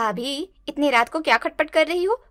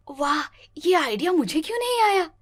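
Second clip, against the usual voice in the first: normal talking voice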